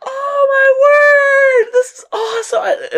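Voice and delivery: very high, loud, gushing delight; thrilled squeal. Retold or imitated. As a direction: squeal it like an opened dream gift.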